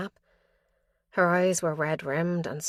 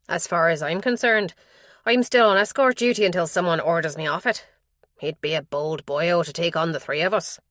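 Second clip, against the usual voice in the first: a hint of a harsh Donegal accent, louder; 6 dB louder than usual. Constable outside the door tells the detective the news, in a light Donegal accent. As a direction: speak it with a light Irish Donegal lilt, firm and loud. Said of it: "The Donegal accent was harsh".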